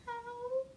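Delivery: squeaky little ow; high, soft mock yelp of pain. Shown as a demonstration. Teacher's playful elephant yelp, high and soft. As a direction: whimper the ow up high. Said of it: "that's just an example".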